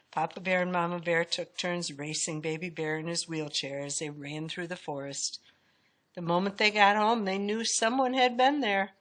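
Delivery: ordinary delivery, nothing marked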